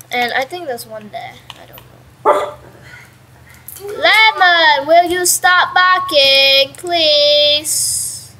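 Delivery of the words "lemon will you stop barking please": loud, slow, raised plea; scolding the dog. Real, not acted. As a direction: call out sternly to a barking pet.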